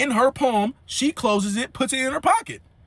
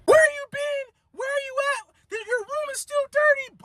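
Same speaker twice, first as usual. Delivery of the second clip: high, shrill-pitched scolding mother; mama going off. Retold or imitated. Mom scolding her grown son, high mock voice. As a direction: squeal it out like an angry mom. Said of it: "She starts going off on the homie".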